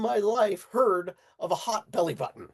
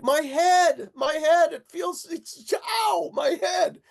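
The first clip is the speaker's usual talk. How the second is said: much higher and louder, crying out about his head; pained shout; 10 semitones higher, 5 dB louder, slower than usual. Retold or imitated. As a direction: yell out in sudden pain. Friend in pain, head.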